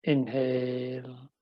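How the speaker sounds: slow, calm breathing cues; guided meditation. Acted. (медитативный спокойный голос)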